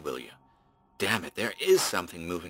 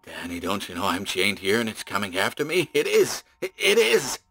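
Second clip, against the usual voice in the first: higher, softer, rising panic; breaking into panic; terrified; 4 semitones higher, 4 dB quieter than usual. Acted. Chained man hears creature approach.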